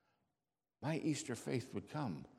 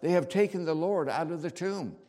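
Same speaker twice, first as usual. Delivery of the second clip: loud, higher urgent outburst; urgent, raised-voice announcement; blurted out; 5 semitones higher, 8 dB louder than usual. A narrator's reading. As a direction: blurt the news out in alarm. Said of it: "Mary blurted out".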